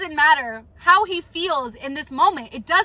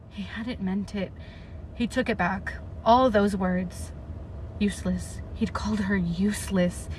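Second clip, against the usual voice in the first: much softer, near-murmured read-aloud; hushed narration; 6 semitones lower, 7 dB quieter than usual. A narrator's reading. Vlogger reading Adian's despair aloud, hushed narration. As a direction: drop to a hush for Adian's despair. Reads novel passage aloud.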